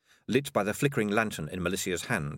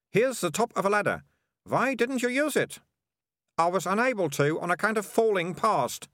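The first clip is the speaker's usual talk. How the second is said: lightly voiced impatient young girl, higher; bossy girl; 11 semitones higher than usual. Melissa dismisses Keith from the trapdoor, lightly voiced bossy girl. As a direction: brush off the complaint like a know-it-all girl.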